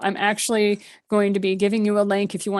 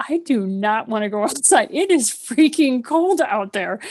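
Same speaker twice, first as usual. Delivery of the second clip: reluctant whine; 3 semitones higher than usual. Retold or imitated. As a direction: drag it out like you really don't want to go. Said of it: "And I'm like".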